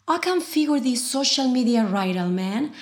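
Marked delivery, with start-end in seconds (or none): none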